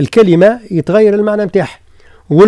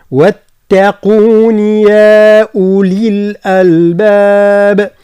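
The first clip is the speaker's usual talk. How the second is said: slow, drawn-out tajweed chant; Quran recitation; 3 semitones higher, 3 dB louder, much slower than usual. Shown as a demonstration. Teacher recites the verse for students, slow chant. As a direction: recite it long and steady. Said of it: "هكذا".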